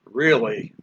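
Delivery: low, falling, annoyed "Really". Shown as a demonstration. Reacting to someone doing something annoying, annoyed. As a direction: drop the pitch in annoyance. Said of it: "annoyed with it".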